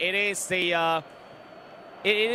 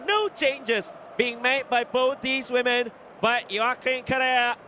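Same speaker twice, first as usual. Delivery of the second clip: normal talking voice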